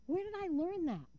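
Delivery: ordinary delivery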